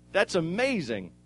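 ordinary delivery

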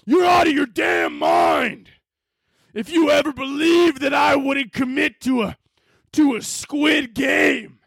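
slow, high-pitched hammed-up outrage; crazed dramatic shouting. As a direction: stretch it out as an unhinged movie yell. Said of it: "going crazy".

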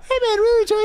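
put-on high, loud squeaky kid; kid commenter voice. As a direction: imitate a twelve-year-old fan. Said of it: "like, twelve?".

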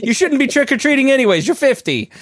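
high, fast teasing retort; mock scolding. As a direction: tease the grown-up trick-or-treaters. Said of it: "It's like".

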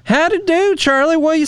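high, hokey howdy; hammed-up cowboy greeting. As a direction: play a goofy cowboy saying hello. Hammed-up greeting back to cohost.

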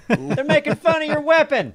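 said through a laugh; high, loud and giggly. Real, not acted.

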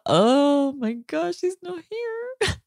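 high, slow, softening voice, as if about to cry; retold tearful realization. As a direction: imitate being hit by sudden grief. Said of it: "It was really tough".

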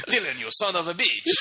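hammed-up French accent, laughing through it; a silly fake French accent on a movie line; mock French accent. Friend jokes that the movie was dubbed with French accents, laughing. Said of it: "imagine it was accented".

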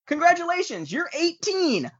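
high, sing-song bureaucrat announcement; mock official voice. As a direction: parody a cheery government clerk. Mocking voice of the law.

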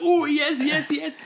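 high, loud "oh yes yes"; hammed-up delighted customer voice; eager punter. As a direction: squeal it out like a thrilled punter. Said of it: "and he's like".

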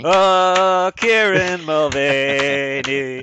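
loud, slow, drawn-out ring announcer. Pretend. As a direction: boom the name like a fight announcer. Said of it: "Nice callback to the beginning of the show".

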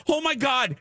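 very high retold panic; panicked cry. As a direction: squeal it as if caught out, acting. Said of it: "I'm feeling the anxiety".

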